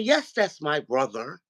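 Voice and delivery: usual speaking voice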